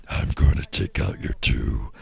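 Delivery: low, slow, breathy taunt; player voice. Acted. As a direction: growl the threat back at him. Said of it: "getting better at switching between those two voices".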